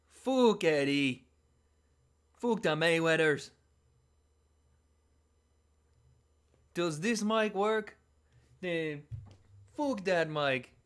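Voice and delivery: slow, loud Irish brawler trash talk; mock Conor McGregor, Irish accent, drawn out; Conor McGregor impression. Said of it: "I sound like Conor McGregor".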